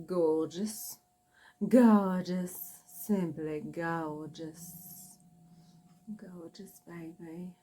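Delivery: drawn-out Geordie "gorgeous", fading to a whisper; slow, indulgent Geordie celeb impression; Catherine Ryan's Geordie impersonation. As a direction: savour each word in a mock Geordie accent. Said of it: "Catherine Ryan's impersonation of".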